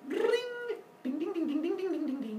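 high, ringing plucked-string noise; imitated strumming. Baby strumming his new shell, imitated twangs. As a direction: mimic strings with repeated high dings. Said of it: "then starts strumming on it".

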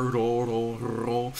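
"ro" with a quick tapped R. Shown as a demonstration. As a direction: flick the tongue once for the R in "ro". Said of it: "tap R".

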